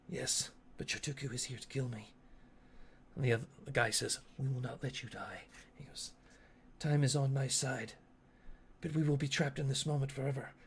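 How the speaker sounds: very soft, solemn in-character voice; grave quiet figures. Acted. Asteri confides in his soldiers, hushed and grave.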